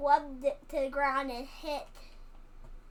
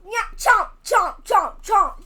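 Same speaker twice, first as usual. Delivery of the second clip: loud mock chewing sounds; chomping noises; 4 semitones higher, 9 dB louder than usual. Child voicing Turtle Man gobbling the sandwich, loud chomps. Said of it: "He goes".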